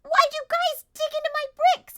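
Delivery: loud, high outraged shouting; outraged yell. Acted. Player yelling outraged at the fae for digging into the bricks. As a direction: rage at the vandals. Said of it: "And I'm like".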